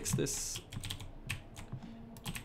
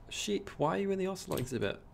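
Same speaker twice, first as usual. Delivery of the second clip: surprised; 9 semitones higher, faster than usual. Real, not acted. Sheep found in wrong exhibit.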